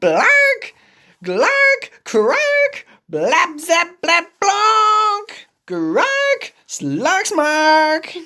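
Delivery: high loud honking toots; big finish trumpet blasts. Acted. Elephant's big finale, loud high honks. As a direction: honk out a grand finale.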